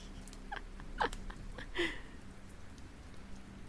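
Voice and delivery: squealing high laugh; high laughter. Real, not acted.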